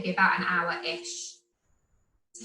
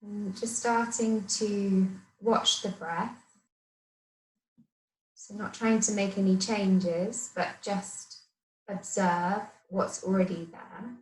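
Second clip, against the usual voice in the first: guided meditation. Acted. Yoga teacher settling the class to watch the breath, guided meditation. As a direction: talk them gently into stillness, phrase by phrase.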